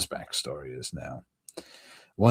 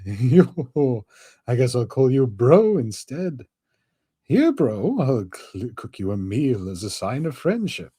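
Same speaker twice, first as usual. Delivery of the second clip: loud high goofy voice; high excited skeleton voice; 6 semitones higher, 3 dB louder than usual. Acted. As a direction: exclaim in a high silly voice. Voices Brook.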